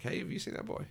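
high, hushed, half-whispered clueless tiger; mock Shere Khan, a high whispery bumbler asking after the boy; bumbling Shere Khan. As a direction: play the dim tiger sniffing about, high and breathy. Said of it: "Shere Khan is just sort of bumbling around like".

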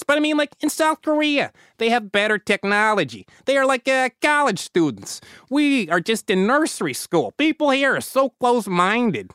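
put-on comical Chicago accent, pitched well up; hammed-up Chicago guy voice. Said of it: "go with this pure Chicago".

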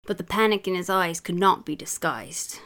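story read in a bad British accent; hammy British accent, lively storytelling; put-on British accent. Mum reading a children's story for laughs, put-on British accent. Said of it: "throw a little accent in there".

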